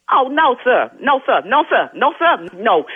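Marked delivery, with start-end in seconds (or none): none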